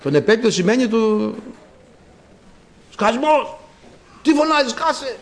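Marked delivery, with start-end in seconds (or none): 2.9-5.2 high, sharp telling-off; acted scolding. Crowd rebukes the blind man for shouting, high angry scolding. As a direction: play a crowd hushing someone rudely. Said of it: "Τον μαλώνανε".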